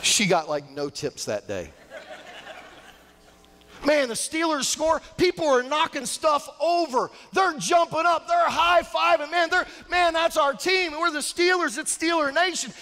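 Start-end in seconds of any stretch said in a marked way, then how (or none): 3.8-12.7 high, breathless-paced sports fan rant; hyped-up fan excitement. Acted. Preacher acting out Steelers fans in a restaurant, high excited shouting. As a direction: get carried away like a fan at a touchdown.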